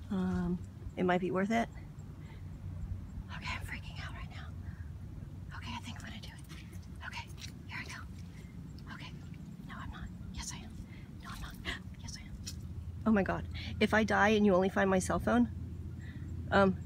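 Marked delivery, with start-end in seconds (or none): 3.4-12.3 hushed, anxious self-argument; nervous whisper. Real, not acted. Woman argues with herself in whispers before wading in. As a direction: whisper yes and no at yourself.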